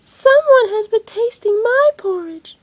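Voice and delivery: high, loud, slow little bear voice; little baby voice. A narrator's reading. Baby Bear cries over his chair, high baby voice. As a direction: squeak it out like a tiny cub. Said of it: "said Baby Bear in a little baby voice".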